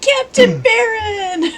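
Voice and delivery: high, drawn-out mock wail; anguished cry. Player calling to captain after explosion, hammed-up anguished cry.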